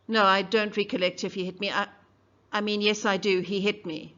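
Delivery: put-on loud, fast, defensive young woman blurting answers; flustered witness. Mayella on the stand, flustered.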